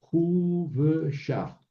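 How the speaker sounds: "Hu, ve, shaf" sounded out, "Hu" held high; syllable-by-syllable demo. Shown as a demonstration. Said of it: "Por ejemplo".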